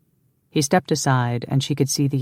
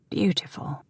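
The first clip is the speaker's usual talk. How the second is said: soft, breathy whisper of praise; hushed admiring whisper; 4 semitones higher, 7 dB quieter than usual. A narrator's reading. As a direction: breathe it softly. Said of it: "he whispered".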